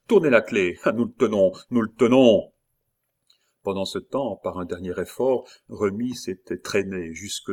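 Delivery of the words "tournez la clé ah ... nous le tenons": loud, raised triumphant call; shout, building to the last words; forceful cry of command; shouted order. A narrator's reading. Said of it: "cria Montsoreau".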